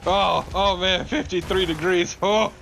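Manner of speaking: teasing a Florida friend about his mild weather, mock pity; mock sympathy. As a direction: pretend to pity him for his cold snap. Mocking friend's "cold" Florida weather.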